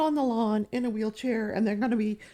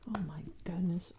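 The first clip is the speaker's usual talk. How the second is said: whisper; 4 semitones lower, 7 dB quieter than usual. Real, not acted.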